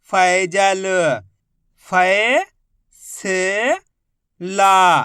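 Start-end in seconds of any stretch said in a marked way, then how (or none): 1.9-5.0 फै-से-ला dragged out slowly, each syllable held apart. Shown as a demonstration. Teacher sounding out a word very slowly for children, syllable by syllable. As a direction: stretch फै-से-ला into long separate beats. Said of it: "तो मिलके बन जाएगा".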